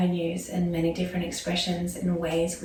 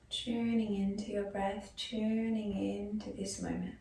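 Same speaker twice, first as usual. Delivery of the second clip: softer yoga nidra voice with long pauses; guided meditation; 10 dB quieter than usual. Acted. Yoga teacher guiding students into breath and body awareness, soft meditation voice. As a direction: ease them gently into stillness, leaving space after each phrase.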